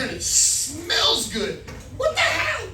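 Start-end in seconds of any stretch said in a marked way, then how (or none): 2.0-2.7 high-pitched squawk of disbelief; incredulous comic outburst; mock astonishment. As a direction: blurt it out high, amazed at the sight. Amazed at plush women's restroom.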